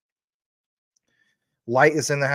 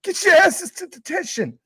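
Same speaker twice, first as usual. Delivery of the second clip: Principal McVicker; 14 semitones higher than usual. Retold or imitated. Streamer repeats the principal yelling at students. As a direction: screech the detention order. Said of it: "That's probably the most I said that word".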